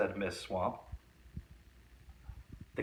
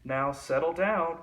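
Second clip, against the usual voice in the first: a touch of sweet teacher voice, slightly higher; 6 semitones higher, 4 dB louder than usual. A narrator's reading. Kind teacher gently calming her rowdy class, lightly sweet. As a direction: gently coax the class to settle. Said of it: "said Miss Nelson in a sweet voice".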